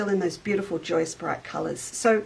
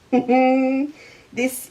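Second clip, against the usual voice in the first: laughing; 5 semitones higher, 5 dB louder than usual. Real, not acted. Delighted laugh over fabric.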